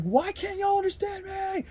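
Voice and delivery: very high shout; screaming in terror; desperate cry. Retold or imitated.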